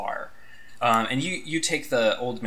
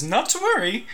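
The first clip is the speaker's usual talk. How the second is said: put-on cheery old man; 12 semitones higher than usual. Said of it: "the old man says".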